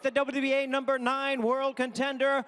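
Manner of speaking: loud, slow, drawn-out fighter introduction; ring announcer call. Real, not acted. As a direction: stretch the name out for the crowd.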